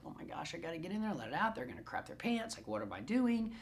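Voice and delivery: fast hushed panic; flustered whispered panic; panicked whisper. Pretend. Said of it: "And you're just like".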